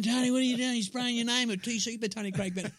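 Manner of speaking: high-pitched mock telling-off; high mock-scolding voice; scolding woman. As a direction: imitate her scolding, breaking into laughter. Mimicking a woman scolding his brother.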